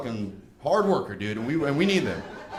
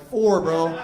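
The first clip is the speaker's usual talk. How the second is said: loud retort; 2 semitones higher, 7 dB louder than usual. Retold or imitated. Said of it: "I'm like".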